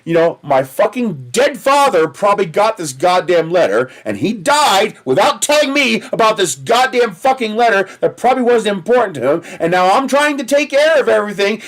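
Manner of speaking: ranting at the water company clerk, mock furious; retold angry rant. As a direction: vent it at her, half laughing at yourself. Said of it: "screaming at this lady".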